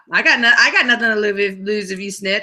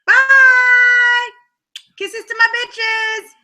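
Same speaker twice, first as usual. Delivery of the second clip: loud goodbye; 10 semitones higher, 4 dB louder, much slower than usual. Real, not acted. Waving goodbye to audience.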